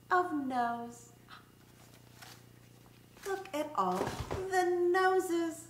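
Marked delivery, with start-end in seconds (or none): none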